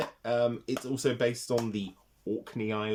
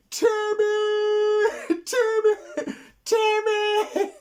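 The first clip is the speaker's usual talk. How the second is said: much higher, loud mock scream; high, loud hammed-up yelling of Timmy; 9 dB louder, slower than usual. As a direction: shriek the name in a panic. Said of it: "everyone just starts screaming".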